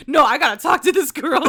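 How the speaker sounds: retelling a dramatic friend; mock insistent. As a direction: push it like she can't be stopped. Voicing Juliet.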